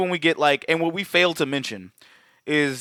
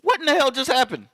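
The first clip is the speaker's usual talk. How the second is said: higher, amazed "what in the hell"; stunned disbelief; 7 semitones higher than usual. Retold or imitated. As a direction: gasp it out like the audience can't believe it. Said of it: "we're sitting there going like".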